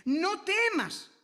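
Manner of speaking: TEMAS drawn out slowly on a high pitch; emphatic proclamation. Real, not acted. Preacher naming the most repeated command, "no temas" slow and high. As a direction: lift "no temas" high and stretch TEMAS.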